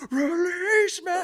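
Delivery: high, strained sung scream; imitating the screamed lyric; screaming along. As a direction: cry it out while driving, singing along. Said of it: "I'm just, like, crying".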